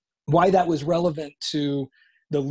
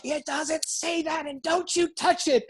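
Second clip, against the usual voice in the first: imitated angry mom; 12 semitones higher, 3 dB quieter than usual. Mother guarding her scriptures from her son, imitated seething anger. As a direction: mimic a mother seething in a much higher voice. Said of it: "volcano of anger".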